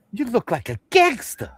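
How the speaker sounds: high playful mom imitation; mom quote. First take of mom quote.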